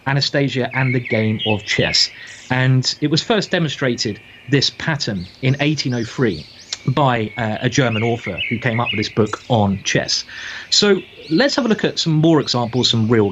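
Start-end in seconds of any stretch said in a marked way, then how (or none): none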